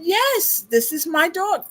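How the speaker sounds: high, emphatic father's reply; imitating proud father. Father claiming the dog before buzzing fans, imitated high reply. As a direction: play Dad answering high and emphatically. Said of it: "And my father said".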